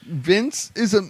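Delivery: high, halting line delivery; [name]. Acted. Mocking the boss's speech about Vince, high and halting. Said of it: "Let me flub these lines".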